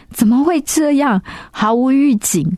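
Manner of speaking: a sudden pained wail of disbelief; anguished cry. Real, not acted. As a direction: voice jumps high in despair. Despairing outburst over lost baby. (绝望地高声质问)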